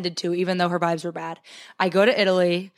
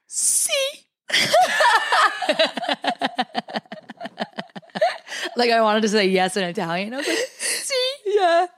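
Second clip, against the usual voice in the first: high, crying voice, breaking; sobbing; 6 semitones higher than usual. Retold or imitated. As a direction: choke it out through sobs. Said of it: "in my sobbing".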